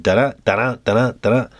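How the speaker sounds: "da-da" said with the second beat stressed; light sing-song da-DA rhythm demo; iambic rhythm. Shown as a demonstration. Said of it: "the unaccented, accented".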